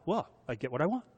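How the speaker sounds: put-on quick, smug little-kid voice; lightly voiced bratty child, fast and cocky; smug spoiled child. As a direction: play the bratty kid who knows he wins. Child voicing a spoiled tantrum-thrower.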